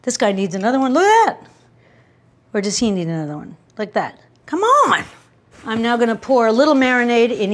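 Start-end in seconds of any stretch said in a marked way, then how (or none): 0.9-1.5 delighted squeal. Real, not acted. Cook admiring a filled dish to viewers, delighted squeal. 4.5-5.0 delighted squeal. Real, not acted. Cook admiring a filled dish to viewers, delighted squeal. Coaxing shrimp into bowl.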